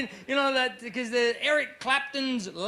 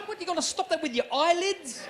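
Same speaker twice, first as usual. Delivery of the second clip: normal talking voice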